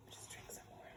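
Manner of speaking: whispered aside to self. Real, not acted. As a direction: sneak the line under your breath. Sneaking wine on camera.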